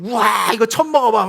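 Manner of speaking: mock excited gush. 식당에서 떠드는 형제를 흉내 내며 과장된 감탄. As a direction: act amazed at the first bite. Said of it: "막 이렇게 하잖아요".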